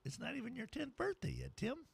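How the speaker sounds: high, soft parent voice, lightly done; mock parent reply. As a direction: answer like a mildly amused mother. Parent answering the deep-voiced kid.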